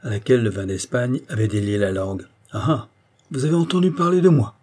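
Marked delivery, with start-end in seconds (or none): 2.5-4.6 high, loud, bantering question; jeering playfully, voice lifted; teasing mockery. A narrator's reading. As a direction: tease her with mock astonishment. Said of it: "demanda-t-il d'un ton goguenard".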